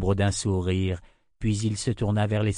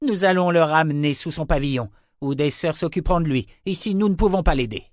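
higher, louder commanding call; urgent shout; 6 semitones higher, 4 dB louder than usual. A narrator's reading. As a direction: keep shouting orders over the din. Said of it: "cria-t-il".

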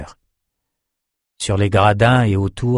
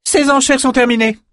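much higher, loud decree; powerful command; 4 dB louder than usual. A narrator's reading. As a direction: end it with authority. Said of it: "lança une voix puissante".

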